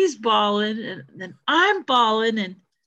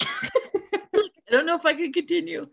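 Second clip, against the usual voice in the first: laughing; 7 semitones higher, slower than usual. Retold or imitated. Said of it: "imitating Cameron reading".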